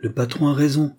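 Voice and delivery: ordinary delivery, nothing marked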